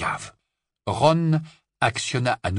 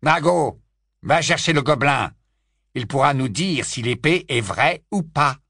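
louder, commanding man's voice; cold aristocrat; 5 semitones higher, 5 dB louder than usual. A narrator's reading. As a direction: order the son about. Lucius gives orders.

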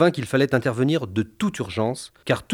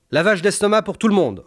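high, crisp doctor's command; commanding order; 8 semitones higher than usual. Acted. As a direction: bark the order like a doctor in charge. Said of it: "ordonna le médecin".

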